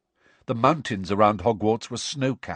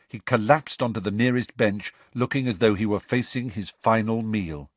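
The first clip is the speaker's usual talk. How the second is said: ordinary delivery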